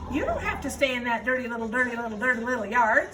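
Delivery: fast, chattering goose voice; fast-talking goose. Acted. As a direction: rattle it off like a busy goose. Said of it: "who talked rather fast".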